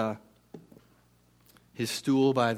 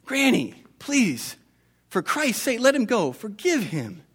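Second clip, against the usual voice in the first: put-on higher, urgent plea to the old woman; pleading Martin; 8 semitones higher, slower than usual. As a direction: beg her, pitch lifted with urgency.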